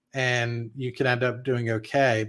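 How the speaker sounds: ordinary talk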